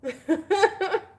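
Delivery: high cackle of delight; high gleeful laugh. Real, not acted. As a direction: laugh high and loud at the troll. Laughing at troll.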